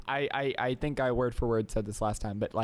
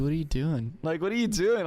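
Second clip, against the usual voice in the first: incredulous question, rising higher on the repeat; mock self-scolding; 5 semitones higher than usual. Host catches themself drinking late coffee, mock scolding. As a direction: tell yourself off in rising disbelief.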